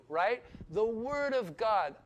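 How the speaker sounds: ordinary talk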